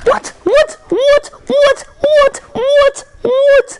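squeaky, insistent string of whats; high repeated what. Real, not acted. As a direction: keep firing off a high-pitched what.

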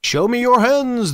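commanding. Acted. Hidden voice orders visitors from the darkness, commanding. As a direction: call it out as a firm demand. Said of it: "a voice commanded".

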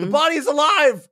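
high, loud hammed-up panicked yell; mock horror panic. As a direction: shriek like a horrified reader at a monster. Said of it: "the reaction to the horror scene".

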